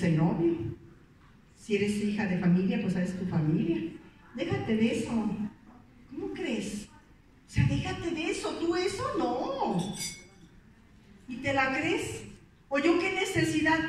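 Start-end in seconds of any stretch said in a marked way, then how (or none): 4.3-9.9 mocking inner critic. Imitating the doubting inner voice.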